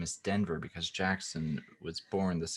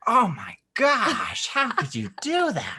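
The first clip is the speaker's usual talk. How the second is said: imitating her, much higher, slow and drawn out; hammed-up disbelief; mock shocked wife; 7 dB louder, slower than usual. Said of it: "She's like".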